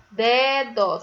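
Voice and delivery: "dedo" split as "dee-dó"; two separate beats on "dedo"; syllable by syllable. Shown as a demonstration. Teacher asking pupils to count, "dedo" syllable by syllable. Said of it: "Tem quantas sílabas?".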